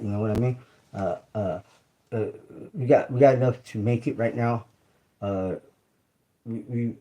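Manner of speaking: usual speaking voice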